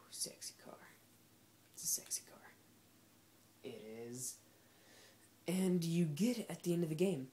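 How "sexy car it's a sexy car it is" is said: hushed, voiceless whisper; admiring whisper. Real, not acted. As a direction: whisper it in awe of the car. Admiring a car in game.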